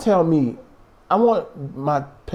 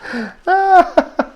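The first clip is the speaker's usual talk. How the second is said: high burst of laughter; 4 dB louder than usual. Real, not acted. Cracks up at cohost.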